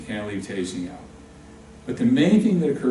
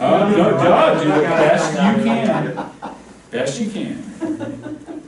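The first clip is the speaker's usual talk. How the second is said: laughing; 4 semitones higher, 8 dB louder, slower than usual. Real, not acted. Encouraging a player, laughing.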